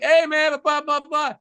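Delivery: high, chummy imitation of saying hi; over-friendly, high-pitched hello; mock eager greeting. Acts out greeting an online acquaintance.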